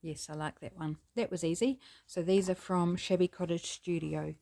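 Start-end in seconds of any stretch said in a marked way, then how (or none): none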